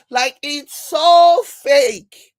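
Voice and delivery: "so" drawn out long and loud; loud, slow emphasis on "so fake"; emphatic SO. Real, not acted. Exclaims at how fake it is.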